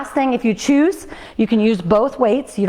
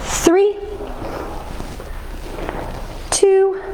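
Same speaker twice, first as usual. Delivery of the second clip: rising countdown; 5 semitones higher, much slower than usual. Real, not acted. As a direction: push them through the final count. Coach counting down final reps.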